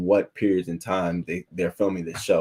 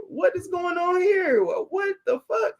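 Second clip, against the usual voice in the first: shocked disbelief. Retold or imitated. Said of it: "But I said".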